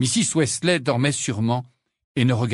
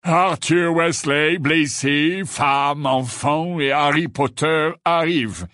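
slow, drawn-out bored listing; languid, tired drone; weary recital; 3 semitones higher, slower than usual. A narrator's reading. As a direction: recite it back wearily, stretching the words. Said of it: "récita Phineas d'une voix lasse".

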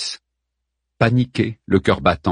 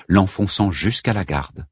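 plain reading voice

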